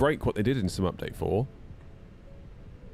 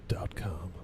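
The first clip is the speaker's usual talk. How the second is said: whisper; 8 dB quieter than usual. Real, not acted. Sponsor plug tail.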